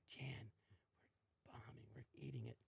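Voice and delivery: fast hushed whisper to a castmate; panicked stage whisper mid-sketch. Retold or imitated. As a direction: whisper urgently so the audience can't hear. Whispering to castmate during bombing sketch.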